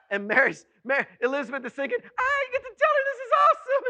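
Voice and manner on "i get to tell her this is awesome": very high, fast, loud squeal; giddy glee. Acted. Preacher voicing Elizabeth eager to tell Mary, giddy squeal. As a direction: squeal it out, bursting to share. Acts Elizabeth's gleeful thought.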